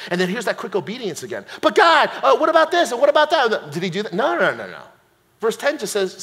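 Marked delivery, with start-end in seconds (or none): 1.6-3.7 higher, hurried mock protest; questioning objection. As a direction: pepper God with quick objections.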